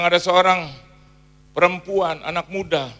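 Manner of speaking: loud, ringing pulpit proclamation; booming word of prophecy; prophetic call. Retold or imitated. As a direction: call it out to the whole church. Preacher calling out prophecy.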